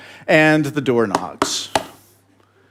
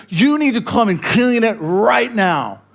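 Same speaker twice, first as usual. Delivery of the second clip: demanding Betty; 7 semitones higher than usual. Retold or imitated. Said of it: "She goes".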